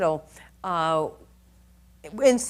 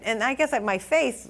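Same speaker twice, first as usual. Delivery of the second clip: ordinary talk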